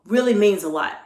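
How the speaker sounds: normal talking voice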